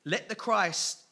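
loud jeer broken by laughter; mocking. Scribes taunting Jesus on the cross, loud and mocking. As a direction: taunt him loudly, laughing as you go. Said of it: "got to read it a bit like that".

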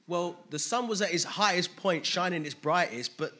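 rising, loud preacher's voice; preaching. Acted. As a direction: preach it out with building fervour. Preaching sermon.

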